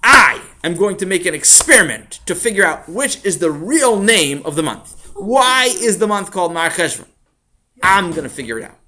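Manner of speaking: put-on loud, high, emphatic boy's resolve; determined declaration. Sammy vows to figure out the month's name, loud and determined. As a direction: announce a big plan at full volume.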